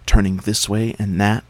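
plain reading voice

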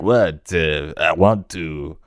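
put-on slow, hammy French accent; a mock French accent, slowly; bad French accent. The time traveller insists, in a bad French accent. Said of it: "Was that a French accent".

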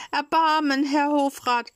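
high, loud begging cry; tearful pleading. A narrator's reading. Puppet begging the director, high and tearful. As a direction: beg again, near tears. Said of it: "weinte und flehte".

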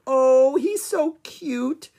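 high, loud, stretched-out gushing; cooing. A narrator's reading. Annie gushes over the tiny bush baby, cooing. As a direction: coo slowly over an adorable little animal. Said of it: "cooed Annie".

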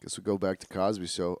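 usual speaking voice

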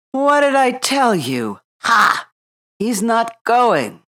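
high, loud told-you-so; gloating animals. A narrator's reading. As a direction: crow that you were right.

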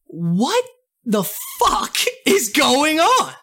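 high, slow laugh-broken outrage; cracking up in disbelief at the post. Real, not acted.

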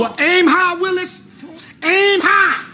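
high-pitched mocking farewell; taunting send-off. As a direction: call it out high and cocky. Taunts coworker as threat is made.

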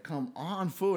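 retold urging. Retold urging friend again.

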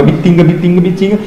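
"Bitinga" with the opening I dropped, hard T; swallowed first vowel. Shown as a demonstration. Classmates shortening his hometown nickname, "Bitinga" minus its first I. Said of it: "as vogais são engolidas".

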